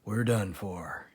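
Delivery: soft, low and defeated; quiet badger, hopeless; softly. A narrator's reading. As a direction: let it sink quietly. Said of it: "he said softly".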